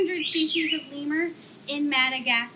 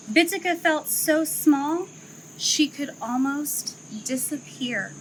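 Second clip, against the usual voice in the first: story narration; 3 semitones lower, 4 dB louder than usual. A narrator's reading. Zoo educator reading a lemur story to children, gently storytelling. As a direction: read it like a picture book for kids.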